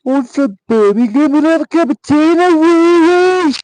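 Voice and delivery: very high, very loud Brummie caricature; mock Birmingham accent. Streamer sending up accents they dislike, mock Birmingham accent. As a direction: ham up a Birmingham accent at a piercing pitch.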